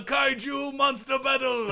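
high hammed-up monster-movie yell; high mock-epic shout; mock recap shout. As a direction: holler it like a dramatic clip. Mock recap of earlier episodes.

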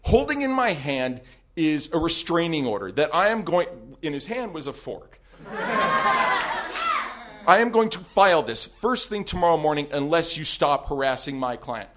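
loud, formal legal threat; attorney's loud threat. Retold or imitated. Attorney on speakerphone warning the stalker, loud and formal. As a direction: announce the restraining order loudly. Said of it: "He goes".

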